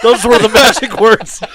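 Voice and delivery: high, loud words through laughter; laughing. Real, not acted. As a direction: crack up while saying it, slowly. Cracks up at the joke.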